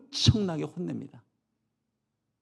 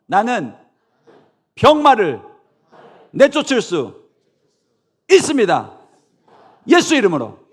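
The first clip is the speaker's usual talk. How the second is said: bold declaration; 6 semitones higher, 14 dB louder than usual. Shown as a demonstration. Leading the congregation's repeat, loud bold declaration. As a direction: declare it with faith. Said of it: "한 번만 더".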